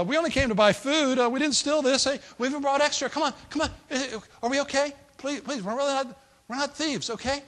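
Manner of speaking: high, hurried protest of innocence; anxious pleading. Acted. Brothers tell steward they didn't steal, anxious pleading.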